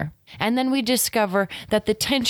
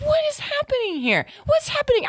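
mock alarm; faster than usual. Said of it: "I'm like".

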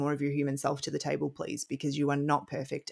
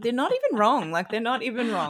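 emphatic agreement; 7 semitones higher, 4 dB louder than usual. Retold or imitated. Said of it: "and I'm like".

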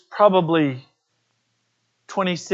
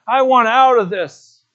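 retold tearful cry, louder and higher; weeping plea, louder; 5 semitones higher, 6 dB louder than usual. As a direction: repeat the plea louder and higher, breaking into tears. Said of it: "he started to weep and say".

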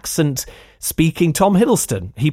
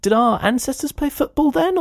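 lightly voiced Doug, pitched higher in naive wonder; a hint of a puzzled caveman; 10 semitones higher than usual. Reviewer voicing Doug's dawning idea, lightly higher and wondering. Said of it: "And he figures".

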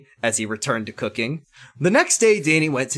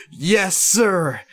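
higher, raised-voice acknowledgement; 6 semitones higher, 4 dB louder than usual. A narrator's reading. Danny answers his officer, raised voice. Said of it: "Danny raised his voice".

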